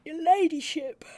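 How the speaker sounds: high, loud, slow Your ladyship; deferential greeting. Acted. Constable greets her ladyship, high and loud.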